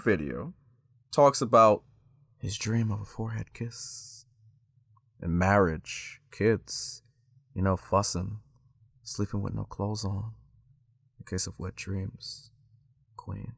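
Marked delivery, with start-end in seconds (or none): 2.4-13.5 Derek Jackson imitation. Listing his third video's dreams as he would, soft and low. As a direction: murmur it softly, lingering between phrases. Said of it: "This is literally how he was speaking".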